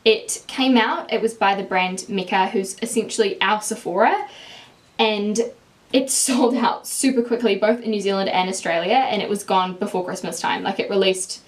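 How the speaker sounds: ordinary talk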